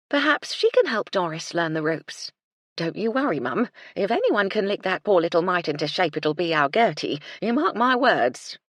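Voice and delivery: loud, cheery cook voice, slowing at the end; a brisk old housekeeper backing her girl; hearty housekeeper. Acted. Housekeeper reassuring Cecily about Gertie, loud and hearty.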